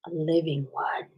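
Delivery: hushed secret whisper. Real, not acted. Whispering to viewers about her hidden live snake.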